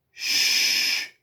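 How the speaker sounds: long unvoiced "shh"; steady hushing hiss; shushing. A narrator's reading. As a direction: hush the wind with a drawn-out shh. Jesus hushing the wind in story.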